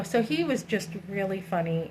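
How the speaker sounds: ordinary delivery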